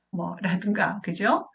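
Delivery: usual speaking voice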